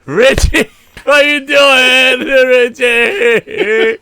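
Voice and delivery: high, drawn-out laughing wail of grief; mock anguished wail. Fan wailing at the TV as Richie dies. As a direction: whine his name like your heart is breaking, half laughing. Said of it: "And I was just like".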